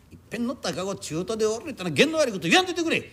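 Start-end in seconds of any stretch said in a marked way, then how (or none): none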